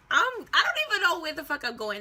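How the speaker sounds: high, loud exasperation; fed up and lost; exasperated. Retold or imitated. Lost in the city.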